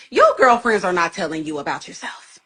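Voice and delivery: ordinary talk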